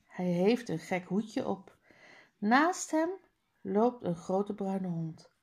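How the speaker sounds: ordinary delivery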